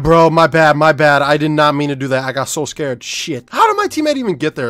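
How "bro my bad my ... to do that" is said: loud apology. Real, not acted. Apologizing to teammate loudly.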